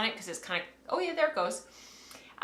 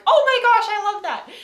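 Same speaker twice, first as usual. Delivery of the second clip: much higher, louder gushing delight; imitating excited friend; 13 semitones higher, 10 dB louder than usual. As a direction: squeal it like a thrilled friend. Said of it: "she was like".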